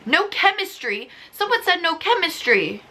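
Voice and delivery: high, disbelieving repeat; high disbelief at no chemistry; incredulous. Real, not acted. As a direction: echo the claim in shock. Disbelief at the review.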